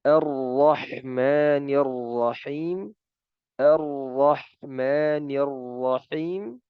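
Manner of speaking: slow, with one natural trill on the r; demo of the trilled ra. Shown as a demonstration. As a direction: roll the r of "Rahmanir" just once, lightly. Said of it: "ro-nya itu kan ada takrir".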